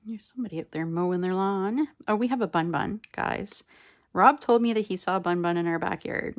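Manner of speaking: ordinary delivery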